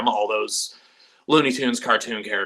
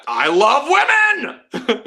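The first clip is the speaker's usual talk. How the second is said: high, drawn-out mock cry, much louder; mock exclamation; 13 semitones higher, 6 dB louder, much slower than usual. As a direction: belt it out as a joke. Mock declaration, then laughs.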